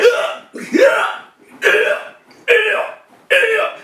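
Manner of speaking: very high squeals of pain; imitated pained yelps. Shot man gets zip-tied, pained yelps. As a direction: yelp high with each pull. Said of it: "that stuff was hurting me".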